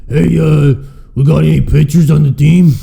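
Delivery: hammed-up booming fan asking for pitchers; imitating a gruff fan. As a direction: call out like a rowdy guy in the stands. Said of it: "He goes".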